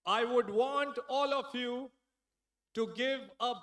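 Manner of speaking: loud, slow, drawn-out emcee call; stage announcer. Acted. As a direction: build the big introduction. (मंच से ज़ोरदार धीमी घोषणा)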